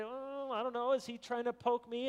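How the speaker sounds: lightly acted, high-pitched questioning voice; wary right-leaning listener. Listener on the right wondering about the preacher, wary mock doubt. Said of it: "people, uh, on the right will say".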